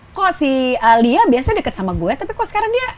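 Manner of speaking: acted bewildered whine, climbing very high; mock-hurt question rising to a high pitch; puzzled complaint. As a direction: play a wounded coworker wondering why. Voices hurt, puzzled coworker.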